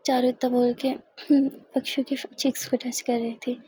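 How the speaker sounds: ordinary delivery, nothing marked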